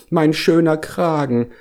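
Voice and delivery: high-pitched, plaintive whine; wailing lament. A narrator's reading. Bear attacked by bees mourning his collar, wailing. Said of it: "jammerte Hieronymus Kragenpeter".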